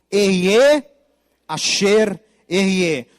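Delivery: loud, slow, drawn-out Hebrew proclamation; booming divine voice. Acted. God proclaiming his name to Moses, booming and slow. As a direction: proclaim each Hebrew word like a voice from heaven. God's answer read in Hebrew.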